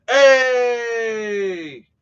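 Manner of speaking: long, high, loud holler; high drawn-out cheer; excited greeting shout. Real, not acted. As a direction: call out a stretched greeting as a friend arrives. Greets Julie arriving in the chat.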